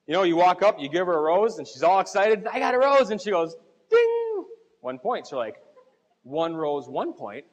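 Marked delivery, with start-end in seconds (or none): 2.5-3.1 mock excited high squeal; playful high-pitched delight; excited wife. Wife excited getting a rose. 3.9-4.4 high sung ding like a bell; playful bell chime; ding sound. Retold or imitated. Scoring sound effect.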